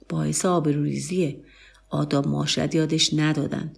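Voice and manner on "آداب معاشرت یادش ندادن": ordinary delivery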